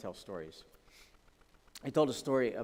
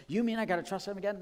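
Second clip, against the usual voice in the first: incredulous objector; 8 semitones higher, 4 dB louder, faster than usual. Retold or imitated. Imagined listener objection.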